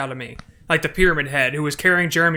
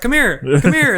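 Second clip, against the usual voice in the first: eager calling; 7 semitones higher, 6 dB louder than usual. Retold or imitated. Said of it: "I was like".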